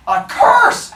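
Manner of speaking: high, loud breathy proclamation; dramatic shout. Acted. Uranus throws a curse at Cronus, high and loud. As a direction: hurl the word like a spell. Father hurls curse at son.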